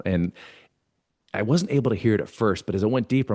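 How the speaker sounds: ordinary talk, nothing marked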